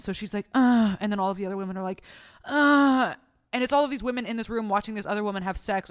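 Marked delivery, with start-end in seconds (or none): 0.5-1.0 short, loud higher moan; hammed-up grunting moan; mock moan. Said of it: "while she's moaning". 2.5-3.1 loud, high echoing moan; chorus back a mock moan; drawn-out copycat moan; mimicked moan. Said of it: "start mimicking her moans".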